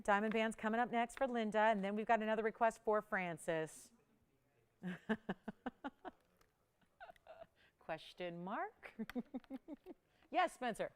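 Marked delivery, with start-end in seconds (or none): 4.8-6.1 soft laugh. Real, not acted. Host laughs at viewer question. 9.0-9.9 soft laugh. Real, not acted. Host laughs at viewer question.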